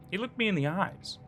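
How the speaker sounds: ordinary delivery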